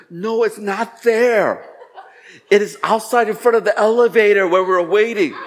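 exasperated correction. Retold or imitated. Wife correcting husband on the phone, exasperated.

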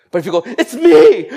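laughing reveal. Retold or imitated. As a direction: blurt it out through laughter. Mock revealing oneself.

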